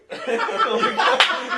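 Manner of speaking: loud squealing laugh; high burst of laughter. Real, not acted. Laughing hard.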